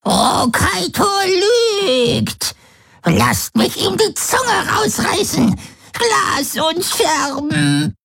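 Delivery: very high, cracked crone croak; shrill mad-hag rasp, falling at the end; croaking old woman. A narrator's reading. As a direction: screech it like a mad old grandmother. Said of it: "krächzte Voi".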